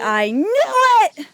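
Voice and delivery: loud acted exclamation; triumphant realisation. Cece realises who is behind it, exclaiming. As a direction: burst out as it all clicks.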